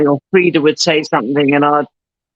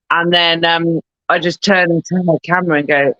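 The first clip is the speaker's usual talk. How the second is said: normal talking voice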